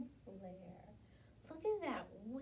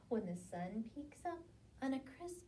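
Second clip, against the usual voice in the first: usual speaking voice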